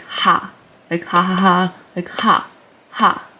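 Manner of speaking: breathy "ha" said over and over; Korean h sound. Shown as a demonstration. Teacher drilling the h sound, "ha". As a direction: push air through each "ha". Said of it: "makes like a h sound".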